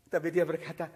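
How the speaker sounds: ordinary talk, nothing marked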